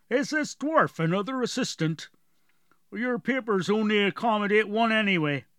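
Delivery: a brusque gate guard questioning travellers; gatekeeper. Acted. Gatekeeper voiced.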